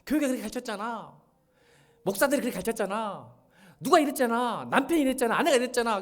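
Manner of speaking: loud, high-pitched blaming outburst; acted-out criticism. Preacher acts out a bitter young doctor blaming everyone, loud accusing. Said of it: "그 세상을 비판했어. 그러니까 이렇게 비판을".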